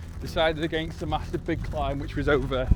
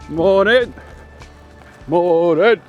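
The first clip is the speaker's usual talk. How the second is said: loud cheery greeting; calling out; 5 semitones higher, 12 dB louder, much slower than usual. Real, not acted. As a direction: call it out to a passer-by. Greeting passers-by on the trail.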